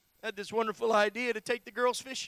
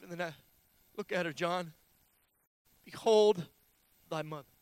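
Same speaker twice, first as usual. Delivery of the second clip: low, halting, solemn words; dying Jesus speaking; 4 semitones lower than usual. Acted. Jesus to John from the cross, low and halting. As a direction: speak low with long pauses, as if expiring. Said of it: "he looks down at John the Beloved and says".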